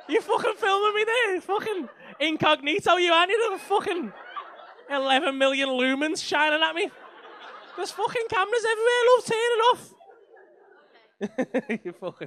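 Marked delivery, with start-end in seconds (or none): 0.1-9.9 high-pitched, worked-up rant; raised, indignant comic outburst; agitated protest. Real, not acted. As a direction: protest as if caught in a spotlight. Ranting at being filmed by audience.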